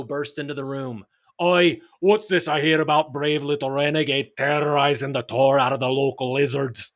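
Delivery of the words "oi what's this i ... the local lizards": a lightly voiced pirate roar; a hint of a gruff pirate growling at the kids. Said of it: "he roared".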